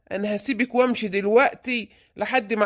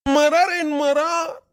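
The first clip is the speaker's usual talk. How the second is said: frightened wail; 5 semitones higher, slower than usual. Acted. Father frightened by the ghost.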